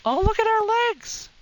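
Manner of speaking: very high, loud burst of delight; excited squeal. Pretend. Player spotting the creature's legs, excited squeal.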